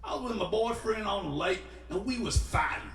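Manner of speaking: imitating the hitchhiker, getting worked up. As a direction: start high and build to an indignant yell. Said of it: "and this is how she talked".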